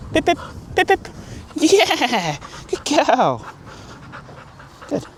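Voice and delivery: high, lilting praise for the dog; praising the dog. Real, not acted. Trainer praising the puppy for coming back, high happy praise. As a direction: lift the voice high to reward her.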